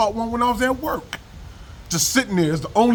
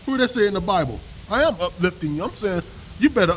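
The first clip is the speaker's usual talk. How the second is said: ordinary delivery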